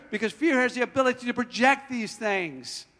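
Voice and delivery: ordinary talk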